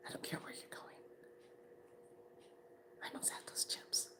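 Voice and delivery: whispered mock ultimatum; menacing whisper. Woman demanding her chips from her friend, menacing whisper. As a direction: whisper the threat, pausing between phrases.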